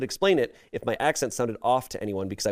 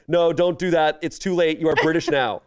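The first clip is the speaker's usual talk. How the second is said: urgent higher-pitched inner voice of anxiety; anxiety's voice; 6 semitones higher, 4 dB louder than usual. Acted. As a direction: play anxiety talking someone out of confessing, pitched up. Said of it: "my anxiety is going".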